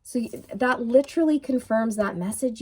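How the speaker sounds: ordinary talk, nothing marked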